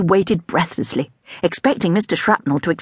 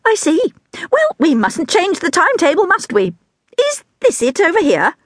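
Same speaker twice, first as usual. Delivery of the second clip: much higher, delighted teacher voice; scatty teacher; 12 semitones higher than usual. A narrator's reading. Scatty teacher showing the class a rubber elephant, much higher. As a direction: hold it up like a treasure, much higher.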